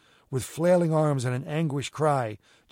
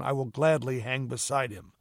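ordinary delivery, nothing marked

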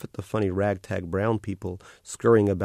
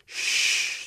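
whispered shush. A narrator's reading. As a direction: hush him gently. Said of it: "she said".

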